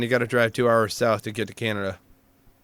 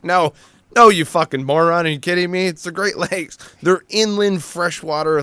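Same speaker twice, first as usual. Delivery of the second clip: exasperated retort; 5 semitones higher than usual. Retold or imitated. Retorting to ignorant recruits.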